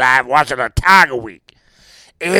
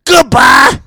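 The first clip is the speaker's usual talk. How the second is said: much higher, loud shouted farewell; shouted goodbye; 9 semitones higher, 7 dB louder than usual. Retold or imitated. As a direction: yell goodbye and hang up on him. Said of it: "And I said".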